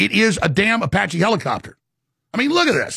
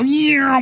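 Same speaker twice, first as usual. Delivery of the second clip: imitated orb sound; 6 semitones higher than usual. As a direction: mimic the little orbs zipping away with "Yeah". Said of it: "and go".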